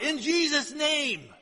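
slow mock call from the back row; imitating the lady calling it out; retold shout. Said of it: "shouted out".

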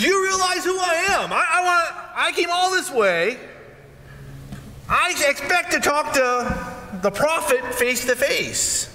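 high, outraged shout slowing down at the end; indignant shout. Retold or imitated. Naaman, insulted by the servant, shouts in high outrage. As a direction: roar it like a snubbed general demanding respect.

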